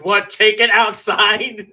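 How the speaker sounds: high, loud, slow teasing take on take it outside; mock joke quote. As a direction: drag out take it outside as a jeer. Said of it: "someone made the joke".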